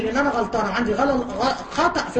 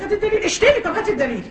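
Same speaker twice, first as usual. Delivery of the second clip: mocking insistence; 8 semitones higher than usual. Mocking opponent's reply.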